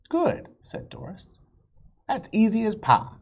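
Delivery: high, bright and loud; waitress breezily reassuring customer; cheerful waitress. A narrator's reading. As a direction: chirp it confidently. Doris cheerful.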